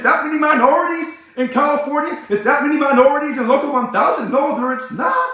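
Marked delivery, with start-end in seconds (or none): none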